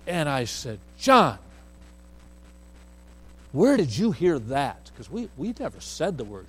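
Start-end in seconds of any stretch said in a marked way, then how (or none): 1.0-4.9 loud, sharp call of his name; stern raised voice. Retold or imitated. Father confronting his son over a rude word, loud stern call. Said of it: "If I just raised my voice like that to him".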